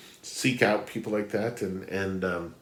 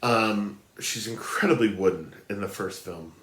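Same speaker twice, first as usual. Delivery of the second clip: ordinary delivery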